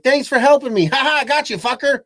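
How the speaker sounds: high, loud gleeful gotcha; mocking betrayal voice. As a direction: taunt him with a laugh. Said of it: "He's like".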